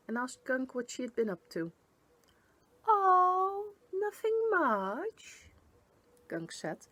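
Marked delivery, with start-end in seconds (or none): 2.9-5.5 high, drawn-out fake-innocent voice; high, slow, far too innocent creature voice; feigned innocence. A narrator's reading. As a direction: play it sweet and innocent, stretching the words. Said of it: "failing to look as innocent".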